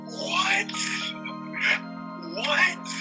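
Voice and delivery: ordinary talk, nothing marked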